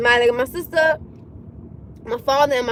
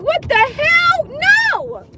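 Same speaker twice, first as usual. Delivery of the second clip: high, drawn-out indignant cry; comic outraged wail; exasperated refusal; 12 semitones higher, 4 dB louder, much slower than usual. Acted. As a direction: refuse outright, stretching it slow and high.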